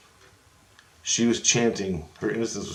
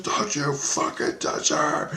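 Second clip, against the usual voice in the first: higher, slow, voiceless screaming rasp; imitated strained scream; 6 semitones higher, slower than usual. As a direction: imitate a man screaming himself hoarse. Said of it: "With his fucking screams".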